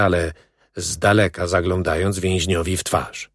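plain reading voice

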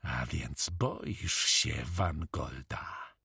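low, slow, quiet menace; restrained, threatening hiss; hissed through clenched teeth. A narrator's reading. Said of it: "wysyczał przez zaciśnięte zęby".

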